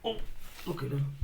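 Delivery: ordinary delivery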